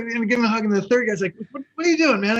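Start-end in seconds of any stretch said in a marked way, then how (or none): 1.5-2.4 high, startled question; imitating a baffled thief. A car thief asking the hugger, high and baffled. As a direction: play the confused thief.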